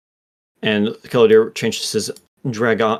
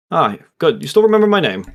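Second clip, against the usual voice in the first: pleased, rising higher; Keladir, amused; 6 semitones higher, 4 dB louder, faster than usual. Acted. Dragon mage greeting his old servant, amused. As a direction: tease him lightly, higher and brighter.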